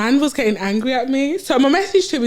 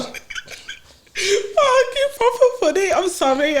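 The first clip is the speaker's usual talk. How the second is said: high, crying with laughter; giggling through the words; laughing hard; 9 semitones higher, much slower than usual. Real, not acted. Cannot stop laughing.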